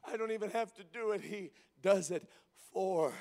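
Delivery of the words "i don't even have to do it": ordinary talk, nothing marked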